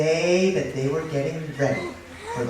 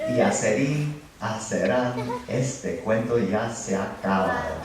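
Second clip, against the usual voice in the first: low, measured Spanish story ending; settled, lowered storyteller sign-off; closing rhyme; 7 semitones lower than usual. A narrator's reading. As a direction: round off the tale with a low-voiced rhyme.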